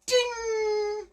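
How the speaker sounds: high sung ding sound. Acted. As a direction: chime it like a bell. Sound effect for handsome.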